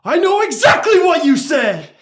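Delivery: high, loud stern shout; big guy barking at a kid; shouted demand. Retold or imitated. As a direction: counselor yelling at the camper. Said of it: "Knox just goes".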